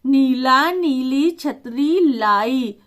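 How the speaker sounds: put-on each word spaced out slowly for a child; slow dictation. Shown as a demonstration. Teacher dictating a sample sentence, slowly. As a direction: read it like dictating to a class. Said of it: "छोटे से sentence, वाक्य बता".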